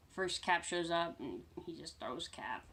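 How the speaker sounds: usual speaking voice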